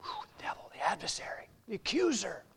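sudden soft unvoiced hush; awed whisper. Real, not acted. Preacher naming the devil to the congregation, hushed whisper. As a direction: drop the voice to name the enemy. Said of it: "preacher drops to awed whisper".

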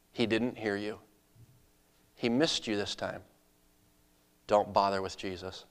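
low, hushed discouragement; inner accusing voice. Acted. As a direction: speak softly like a doubting inner voice. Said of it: "a voice inside of your head".

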